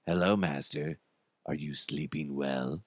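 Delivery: low, hushed, eerie servant; soft deep voice looming over a sleeper; creepy robot. Acted. Robot leans over its sleeping master, creepy low voice.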